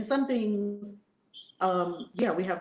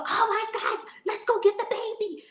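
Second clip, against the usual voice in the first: put-on much higher urgent cry to fetch the baby; panicked rush; 12 semitones higher, 3 dB louder than usual. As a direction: hurry them out, voice shooting up. Parents panic to retrieve baby.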